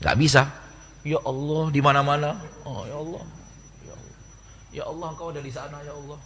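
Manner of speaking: hushed, breathy prayer at a near whisper; mock whispered prayer. Shown as a demonstration. Preacher mocks a confused prayer, in a faint whisper. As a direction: pray faintly as if God were nowhere in particular. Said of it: "begini kok doanya".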